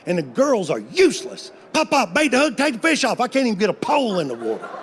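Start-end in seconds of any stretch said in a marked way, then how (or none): 1.7-3.2 put-on pestering kids. Granddaughters pestering grandpa at pond.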